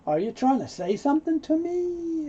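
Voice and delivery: high-pitched affronted question; offended. Acted. Someone bothered by what was said, offended challenge. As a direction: challenge them as if insulted. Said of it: "I'm offended".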